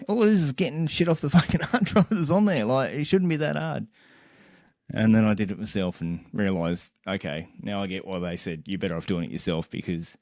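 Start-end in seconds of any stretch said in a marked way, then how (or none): 0.1-3.9 exasperated. Retold or imitated. Exasperated retold complaint.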